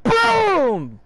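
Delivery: high, loud boom; big high-pitched explosion call; explosive boom. Acted. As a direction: shout it like a blast going off. Mimics the explosion in comic.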